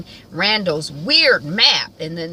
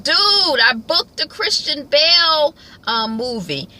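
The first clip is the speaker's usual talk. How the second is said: higher, louder, slow bragging; excited roommate; 9 semitones higher, 5 dB louder, slower than usual. Retold or imitated. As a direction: announce the news with hammed-up pride. Voicing Kevin's roommate.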